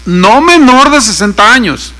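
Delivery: loud pulpit proclamation; scripture reading. Real, not acted. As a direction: read the verse out firmly. Reads the verse aloud.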